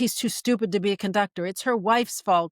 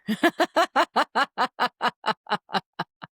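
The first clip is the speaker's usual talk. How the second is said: long, loud peal of laughter falling in pitch; 4 semitones higher, 3 dB louder than usual. Real, not acted. Podcaster laughs that none of it applies to Tar, long falling laugh. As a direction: laugh it out in a long descending run.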